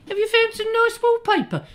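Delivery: cartoonish falsetto, far above own pitch; high mock voice. Chatting to gangster about decorating, very high mock voice. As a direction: ask in a silly high voice. Second character in two-voice skit.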